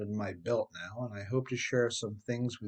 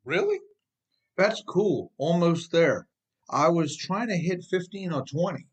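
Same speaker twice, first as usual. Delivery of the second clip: higher, louder mock-casual sarcasm; sarcastic joke; 6 semitones higher, 4 dB louder than usual. Joking back at the trooper, loud sarcastic glee. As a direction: toss it back with cheeky sarcasm. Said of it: "I sarcastically joked back".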